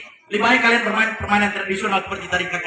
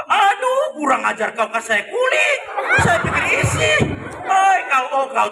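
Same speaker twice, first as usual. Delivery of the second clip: angry character outburst; 9 semitones higher than usual. Acted. Cow angered, given only peel.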